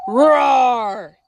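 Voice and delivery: loud growling bear roar. A narrator's reading. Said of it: "the bear growled".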